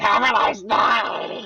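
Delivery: slow, hammed-up drawn-out goodbye; exaggerated mean. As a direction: overdo the mean sendoff. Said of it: "makes it a little bit worse every single time".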